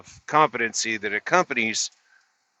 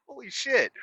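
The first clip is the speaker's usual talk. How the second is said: amazed; 12 semitones higher, 6 dB quieter than usual. Retold or imitated. Stunned at door popping open.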